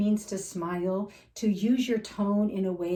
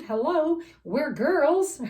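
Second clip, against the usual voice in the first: bright, higher and louder sassy aside; playful exclamation; 8 semitones higher, 5 dB louder, slower than usual. Real, not acted. Playful aside to women audience.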